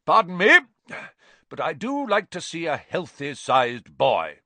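very high, loud, unembarrassed aunt; loud, hearty aunt after a burp; bluff Aunt Marge. A narrator's reading. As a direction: brush off the burp and carry on loudly. After burping.

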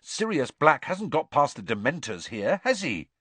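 pointed questioning. A narrator's reading. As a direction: lift the tag question sharply upward. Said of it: "he said pointedly".

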